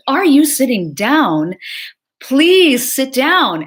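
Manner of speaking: high, loud and slow, pleading; retold panicked scream. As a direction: relive the mother's frantic cry down the phone. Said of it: "she screamed".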